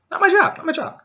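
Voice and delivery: high, loud sing-song refusal; high, loud mock sing-song refusal; shrill refusal. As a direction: toss it off with a shrug. Thief refuses to help.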